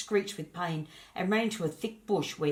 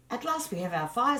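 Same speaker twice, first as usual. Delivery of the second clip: joyful; 7 semitones higher, 4 dB louder than usual. Acted. Great Spirit rejoicing at fire.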